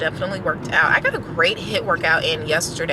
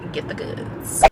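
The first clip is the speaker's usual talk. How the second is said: "Get the goods" whispered playfully; a sly whispered repeat of get the goods; whispered aside; 4 dB quieter, slower than usual. Shown as a demonstration.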